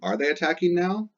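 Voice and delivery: high, sing-song teacher question; asking voice. Shown as a demonstration. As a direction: pitch it up like asking the class. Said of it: "Now we're asking a yes or no question".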